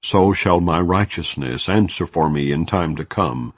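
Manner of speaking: plain reading voice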